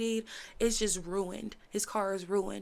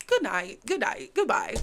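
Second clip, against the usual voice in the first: mock hang-up; 6 dB louder than usual. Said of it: "and he's like".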